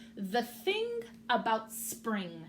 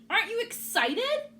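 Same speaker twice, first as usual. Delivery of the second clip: excited; 13 semitones higher, 7 dB louder than usual. Acted. Bird excited question.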